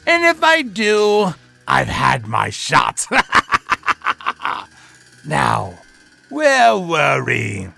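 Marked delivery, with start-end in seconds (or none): 3.1-4.6 breathy sinister chuckle; a wicked little laugh at his own joke; creepy laugh. Acted. Host laughing at his bite joke, sinister chuckle.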